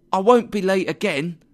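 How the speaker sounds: high, loud pleading clerk; high-pitched, worried apology; anxious promise. A narrator's reading. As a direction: promise earnestly to a cross boss. Late clerk promises angry boss.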